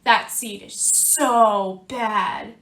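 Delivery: slow, lightly voiced gossip; a hint of disapproving seeds; other seeds gossiping. Bad seed voicing the other seeds, mild slow disapproval. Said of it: "they look at me and they say".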